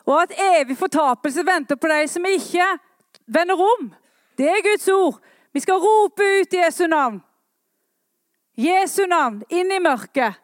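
loud, high, rousing call from the pulpit; fiery revival preacher; full-force preaching. Acted. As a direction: proclaim it to the whole church, ringing out.

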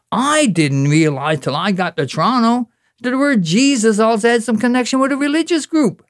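high, incredulous spoken-word protest; comic poem rant. Acted. Poet defending his mistake about Jesus, high exasperated recitation. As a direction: push the pitch well up, baffled and protesting.

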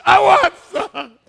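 loud, high-pitched mock tantrum; demanding little kid. Grown man doing his crib tantrum on Alicia, childishly loud. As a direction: draw it out in a toddler's shout. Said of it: "I still do it now with Alicia".

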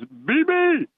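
a high, put-on old coach voice giving an order; imitating the coach. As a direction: mimic the coach calling his assistant over. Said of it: "and eleven says".

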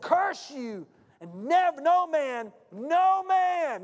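preacher acting out a rising, angry curse; angry curse, retold. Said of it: "he was mad and angry".

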